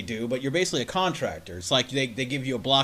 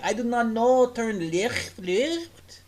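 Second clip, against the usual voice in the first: higher mock foreign driver voice; mock broken English; 7 semitones higher, much slower than usual. Imagining the driver giving directions, higher mock foreign accent. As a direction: fumble directions in a put-on accent. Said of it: "Probably saying".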